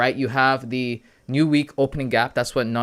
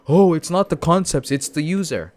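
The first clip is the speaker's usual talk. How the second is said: higher, louder mock objection; exaggerated voice of objecting people; mimicking critics; 6 semitones higher, 6 dB louder than usual. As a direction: imitate the naysayers, pitched up and louder. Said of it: "people are like".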